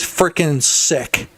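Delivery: drawn out, savoring each word; slow and drawn out. Shown as a demonstration. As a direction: stretch it out slowly. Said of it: "Frickin' sick".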